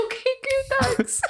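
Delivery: squeaky, laughing relief; high nervous relief. Retold or imitated. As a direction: chirp it out, relieved. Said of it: "I was like".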